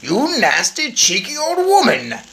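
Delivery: high, loud furious father; angry shout. Acted. Father shouts at the grandmother, high and angry. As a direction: bellow at the old woman. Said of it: "shouted Mister Jenkins".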